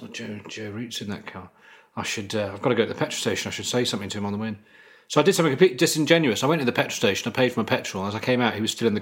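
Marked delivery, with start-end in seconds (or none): 0.0-4.6 soft, quick muttering under the breath; muttering to myself. Retold or imitated. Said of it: "so I said to myself".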